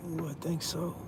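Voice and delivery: lost driver thinking aloud under his breath; muttering to himself. Real, not acted. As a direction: mutter to yourself while checking the road. Driving aside, checking the route.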